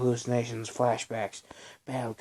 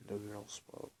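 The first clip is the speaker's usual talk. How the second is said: usual speaking voice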